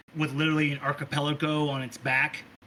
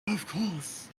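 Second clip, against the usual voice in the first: soft, higher creepy voice; hushed creepy compliance; obliging creep; 4 semitones higher, 6 dB quieter than usual. Acted. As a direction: agree softly in an eerie put-on voice. Creep agrees to keep away.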